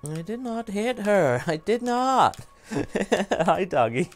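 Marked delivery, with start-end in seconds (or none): 0.1-2.4 high, slow, drawn-out mock protest; hammed-up film quote. Streamer parodies the film's rooftop denial, hammy protest. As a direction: overact the denial like a bad actor.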